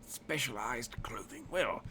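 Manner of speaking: hint of a Cockney salesman; Cockney-tinged tailor. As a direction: weigh the request in a mild Cockney lilt. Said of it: "he's really Cockney instead of".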